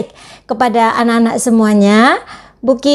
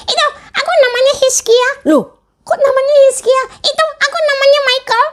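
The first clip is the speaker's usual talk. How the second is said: put-on much higher childlike puppet voice; puppet friend; faster than usual. Teacher voicing her puppet for the children, high puppet voice. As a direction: play the cheeky puppet sidekick, pitched way up. Puppet gives silly names.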